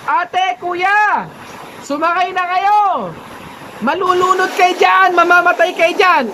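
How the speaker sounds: pilot calling out. Acted. Pilot calls down from helicopter.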